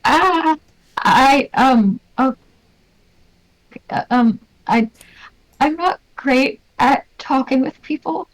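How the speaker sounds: anxious, tripping over her words; nervous shy character. Acted. Shy character nervously declining to talk.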